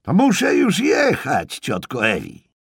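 shouted excuse to leave; booming shout. Acted. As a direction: boom it out for a deaf aunt. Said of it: "huknął George".

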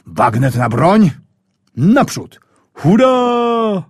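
a rising command that breaks into a high shouted hurrah; rally the platoon as the charge begins; battle cry. Acted. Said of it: "Władek krzyczy".

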